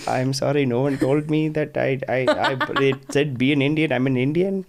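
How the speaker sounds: light put-on Indian accent; fake Indian accent. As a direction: play it in a jokey Indian accent. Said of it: "I decided to have a fake Indian accent".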